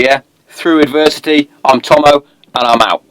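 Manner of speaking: normal talking voice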